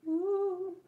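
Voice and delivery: high, drawn-out whoop; excited woo. Real, not acted. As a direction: let out a long high "woo". Cheering at spotting washi tape.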